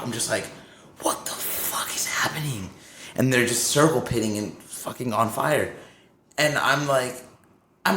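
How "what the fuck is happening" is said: stunned, drawn-back whisper; in shock. Retold or imitated. Bandmate recalls seeing men on fire, shocked whisper. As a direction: whisper it in disbelief, reliving the shock. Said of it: "I'm in shock".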